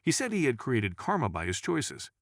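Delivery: usual speaking voice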